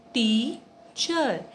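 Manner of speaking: "teacher" split into two separate beats; syllable by syllable. Shown as a demonstration. Teacher showing children a two-syllable word, syllable by syllable. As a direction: break it as "tea-cher", pausing between the parts. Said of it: "These all are two syllable".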